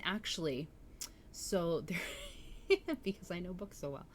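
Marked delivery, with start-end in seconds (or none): 2.0-3.0 breathy laugh. Real, not acted. Laughing at herself mid-sentence.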